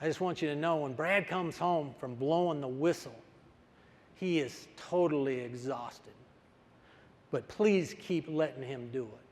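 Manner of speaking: concerned mother. Retold or imitated. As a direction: gently confide it to the coach. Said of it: "his mom came up and said".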